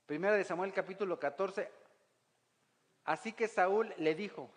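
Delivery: ordinary talk, nothing marked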